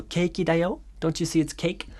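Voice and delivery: 케이크だよ said high and rising like a question; question intonation on 케이크だよ. Shown as a demonstration. Teacher showing students the questioning rise on 케이크だよ. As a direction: lift 케이크だよ up at the end as if asking. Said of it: "question intonation".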